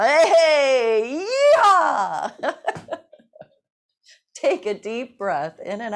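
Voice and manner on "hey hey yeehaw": loud high whooping yeehaw; celebratory yell. Shown as a demonstration. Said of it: "extra yelling, yippies, yahoos".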